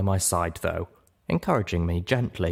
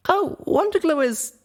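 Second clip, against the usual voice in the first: put-on high, eager young pony voice; small mare walking friends through a rescue; young Littlepip explaining; 3 dB louder than usual. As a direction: lay out the plan, voice pitched up. Littlepip searches for a word.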